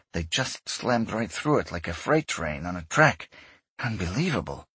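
low, hushed amazement; quiet disbelief. A narrator's reading. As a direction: say it softly, half to yourself. Commander shakes head in disbelief.

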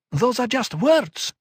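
high, urgent, pleading; desperate insistence; anguished young woman. Acted. Murbella protests.

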